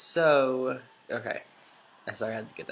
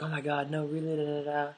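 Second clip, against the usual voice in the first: lightly imitated friends, pitched up; a touch of high-pitched mock surprise; mock disbelief; 4 semitones higher than usual. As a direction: put on a higher incredulous friend voice.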